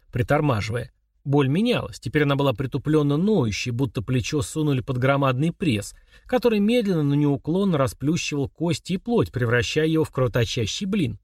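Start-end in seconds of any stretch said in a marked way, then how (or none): none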